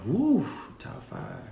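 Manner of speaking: breathy whisper of awe; whispered woo. Real, not acted. Host asked for her top five, whispering in thought. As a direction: let it out on a whisper, drawn out.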